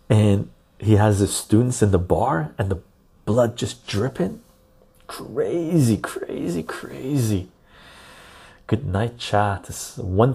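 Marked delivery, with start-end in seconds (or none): 3.2-7.5 excited. Real, not acted. Excited about gory scene.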